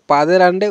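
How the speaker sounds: পাদের আন্ডে without the first n; faulty পাদের আন্ডে, demonstrated; wrong pronunciation. Shown as a demonstration. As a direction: loosen it to পাদের আন্ডে, pa-de-randey. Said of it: "উচ্চারণটা কিন্তু সঠিক না".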